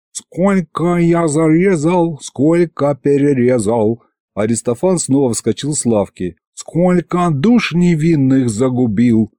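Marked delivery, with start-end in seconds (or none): 0.1-4.0 slow, high mock lament; drawn-out theatrical taunt; taunting demon thug. Demon taunts suspect with mock confession. 6.5-9.3 slow, high mock lament; drawn-out crook's lament; taunting demon thug. Demon continues mock confession.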